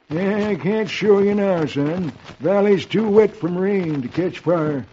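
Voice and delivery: drawn-out hermit voice; hermit character. Acted. Hermit to Roy while unhitching the mule, drawn-out character voice. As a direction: hermit grumbles it out, stretching the words.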